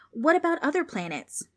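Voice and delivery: put-on a small boy thinking bigger; lightly voiced eager child; eager little boy. Preston eager question.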